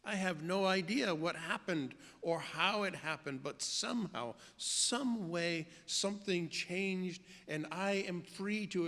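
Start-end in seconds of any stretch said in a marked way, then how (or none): none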